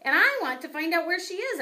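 high, loud upset squeak; squeaky hamster rant. A narrator's reading. Hamster complaining to Og about the class, high upset squeak. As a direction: pour out the worries in a tiny high voice. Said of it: "I added".

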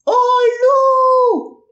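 piercing high cry of wolf; high shrieking cry. Acted. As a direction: shriek it like a child crying wolf. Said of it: "il criait". (cri perçant et aigu)

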